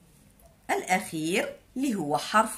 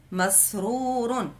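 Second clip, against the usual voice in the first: slow drawn-out word; much slower than usual. Shown as a demonstration. Teacher stretching مسرور slowly for young learners. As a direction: stretch مسرور out slowly, each syllable clear. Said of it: "كلمة مسرور".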